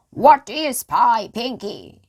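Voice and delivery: loud high pirate call; shouted. A narrator's reading. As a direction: call up to the treehouse loudly. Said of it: "shouted Captain Bones".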